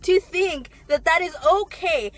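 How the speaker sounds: high, loud outraged rant with a crying edge; furious rant. Real, not acted. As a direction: let the outrage pour out, nearly in tears. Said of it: "fucking rant over".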